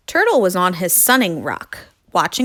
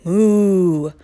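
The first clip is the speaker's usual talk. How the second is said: long drawn-out moo; moaning cow; 3 dB louder than usual. Acted. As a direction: moan a moo, less forcefully. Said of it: "cow moaned".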